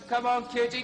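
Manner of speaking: ordinary talk, nothing marked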